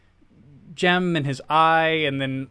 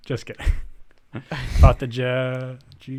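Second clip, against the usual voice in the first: laughing through the words. Real, not acted. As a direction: chuckle while you say it. Laughing at own joke.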